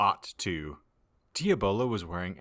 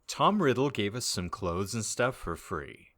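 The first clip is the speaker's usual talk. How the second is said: ordinary delivery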